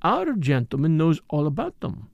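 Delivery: put-on musing accented detective, pitch swinging up; murmured to himself. A narrator's reading. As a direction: puzzle it over aloud, lingering on the thought. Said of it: "he murmured to himself".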